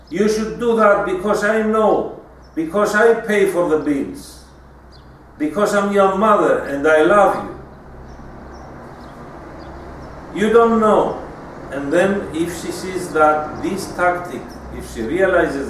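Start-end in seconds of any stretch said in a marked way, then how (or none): 0.1-11.2 louder, bossy mother laying down the law; a mother telling her teenage son she knows best, louder; domineering mother. Retold or imitated. Lecturer voicing a controlling mother ordering her son, loud and bossy. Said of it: "And she is direct".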